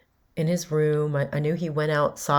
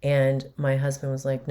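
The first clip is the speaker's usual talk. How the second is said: ordinary delivery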